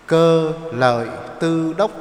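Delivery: "Cơ Lợi Tư Đốc" slowly, each syllable separate; slow, measured "Cơ Lợi Tư Đốc"; Sino-Vietnamese reading. Shown as a demonstration. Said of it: "Tiếng Trung Quốc của nó đọc là".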